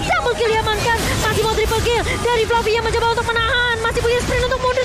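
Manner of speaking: high, fast hyped teamfight call; fighting game announcer. Acted. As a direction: shout the action like a caster.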